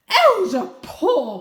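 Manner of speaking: high, loud, very slow outcry; shocked cry. Acted. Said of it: "cried Mother".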